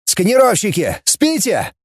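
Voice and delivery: sharp high bark at the crew. Acted. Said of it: "рявкнул".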